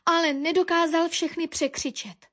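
usual speaking voice

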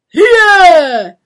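high, very loud driver's yell; shouting. A narrator's reading. Brewery coachman drives his horses on, shouting. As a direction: bellow at the horses to move. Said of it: "křičel".

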